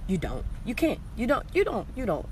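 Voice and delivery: ordinary delivery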